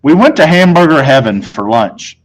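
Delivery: ordinary delivery, nothing marked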